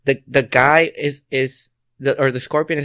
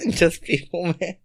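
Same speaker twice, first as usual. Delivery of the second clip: laughing; 4 semitones higher, slower than usual. Retold or imitated. Repeating Jose's line through laughter.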